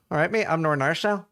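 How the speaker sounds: quick, badly faked Northern Irish accent. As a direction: rush out a poor Northern Irish impersonation. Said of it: "trying to impersonate a Northern Irish accent".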